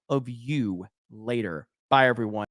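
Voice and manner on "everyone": ordinary talk, nothing marked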